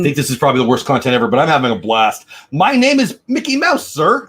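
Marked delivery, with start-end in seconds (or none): 2.5-4.3 high, loud and eager; an excited stand-in for Mickey. Acted. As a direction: bounce in like an excited kid. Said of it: "I can't do that voice either ... excited voice".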